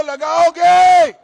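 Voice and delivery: drawn-out rally shout. Real, not acted. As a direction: belt it at the crowd. Rally crowd call and response.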